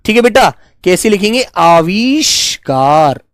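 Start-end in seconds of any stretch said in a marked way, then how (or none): 1.6-3.2 आविष्कार stretched slowly so the long आ rings; slow sounding out. Shown as a demonstration. Teacher sounding out आविष्कार for dictation. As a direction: draw out the आ in आविष्कार. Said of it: "आ की आवाज आती है".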